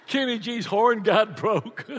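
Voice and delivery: normal talking voice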